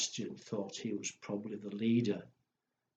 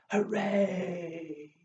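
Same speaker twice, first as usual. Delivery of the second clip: sailors' cheer; 7 semitones higher than usual. Acted. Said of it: "all the other sailors shouted".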